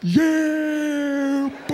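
high, drawn-out whoop; hammed-up victory holler; triumphant yell. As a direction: crow over the beaten enemy. Crowing while chopping the snake.

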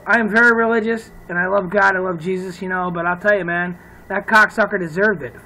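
usual speaking voice

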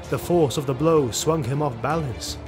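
normal talking voice

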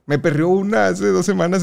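ordinary talk

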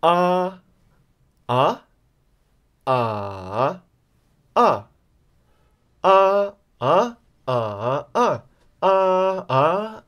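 cycle AH through the four Mandarin tones; four-tone drill. Shown as a demonstration. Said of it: "Are you ready for an exercise".

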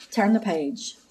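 ordinary delivery

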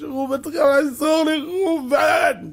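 high, loud calling out to a friend; pleading cry for help; desperate plea. Acted. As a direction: beg your friend for help.

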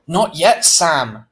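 plain reading voice

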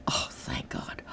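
whispered secret relief; hushed inner voice, relieved. Retold or imitated. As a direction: breathe it out in private relief. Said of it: "half of me is going".

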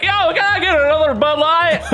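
loud, high-pitched Southern holler; Southern American accent. Retold or imitated. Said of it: "He, like, turns southern".

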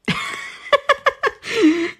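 very high burst of laughter. Real, not acted. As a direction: laugh out at her own trick. Laughing at trick question.

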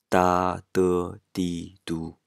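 "ダ、ドゥ、ディ、ドゥ" in level, sustained tones; first tone. Shown as a demonstration. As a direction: hold each of ダ、ドゥ、ディ、ドゥ without any glide. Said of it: "全部一声だと". (「ダ、ドゥ、ディ、ドゥ」を一声で平らに伸ばして)

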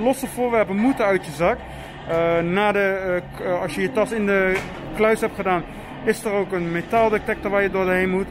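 normal talking voice

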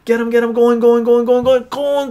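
high, loud going going; excited shout. Real, not acted. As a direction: build to the hammer. Auction climax.

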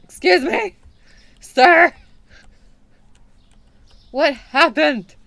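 high, loud startled address; startled call. Real, not acted. Shopkeeper to a strange customer, loud and startled. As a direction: call out in alarm.

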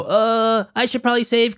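lightly voiced doubtful client; hesitant saver. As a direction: act out a half-hearted excuse.

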